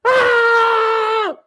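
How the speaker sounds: high yelled "Ah!" of joy; sudden high roar of delight; celebration shout. Real, not acted. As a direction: let out a big high victory shout. Celebrating a last-minute winner.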